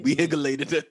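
"higgulated" with a hard, sounded G; G pronounced in highlight. Shown as a demonstration. Host demonstrates the G in highlight, mock-correct "higgulated". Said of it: "gotta pronounce the G in highlight".

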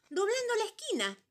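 loud, very high clucky hen voice. Acted. Hen curtly answering number three's question.

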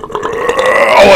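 long, deep burp; burped-out groan. Real, not acted. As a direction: let out a real burp from deep down. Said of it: "it's a deep burp".

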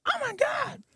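very high-pitched squeal of scandalized surprise; mock shock. Grandma smacked by a celebrity, high mock-shocked squeal.

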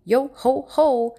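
slow, high, loud yo ho ho; pirate shout. A narrator's reading. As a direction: bellow it as loud as you can. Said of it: "shouted ... as loud as they could".

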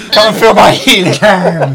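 laughing, loud hammy boast; silly clown. Acted. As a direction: ham it up, giggling loudly.

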